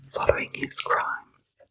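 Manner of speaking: ordinary delivery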